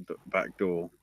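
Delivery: ordinary delivery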